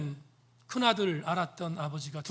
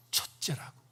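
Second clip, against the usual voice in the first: whisper; 6 dB quieter than usual. Real, not acted. Answering his own question softly.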